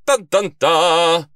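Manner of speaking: loud, high "dun dun dun" fanfare; dramatic sting. A narrator's reading. Detective celebrating the case too loudly, dramatic sting. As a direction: belt the suspense sting, last note held.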